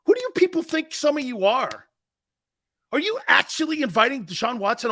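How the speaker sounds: high, indignant challenge. Real, not acted. Host challenges judgmental listeners, indignant. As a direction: pitch it up and take them to task.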